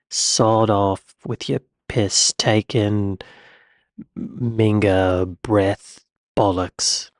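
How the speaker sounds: slow, unpractised mock British accent; drawn-out attempt at British cursing; amateur British swearing. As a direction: try out British insults slowly, word by word. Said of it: "I gotta practice this. It's not very good".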